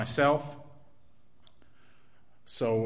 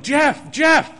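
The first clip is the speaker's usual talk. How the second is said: desperate shout, much higher and louder; frantic yelling; 12 semitones higher, 8 dB louder than usual. Retold or imitated. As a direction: scream his name in panic, searching for him. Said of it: "I'm just yelling".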